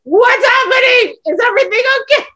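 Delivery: a friend alarmed by the noise; worried friend. Retold or imitated. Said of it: "she's like".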